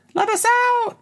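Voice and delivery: very high, squeaky little voice; tiny soldier voice. Pretend. Voicing sperm as little soldiers, squeaky high plea. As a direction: squeak it like a tiny trapped creature. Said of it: "That's how they talk, little soldiers".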